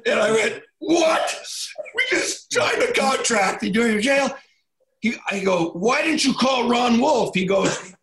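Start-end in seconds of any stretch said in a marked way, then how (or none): 0.8-4.3 much higher, half-shouted disbelief; alarmed retold yell. Coach learns Brett is jailed. 5.7-7.3 slow, higher half-shout of exasperation; demanding answers from his player; exasperated retold yell. Questioning jailed player.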